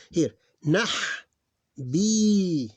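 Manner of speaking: nah then B held long and high; teacher holding the long vowel; sounding out. Shown as a demonstration. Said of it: "I may add this for you".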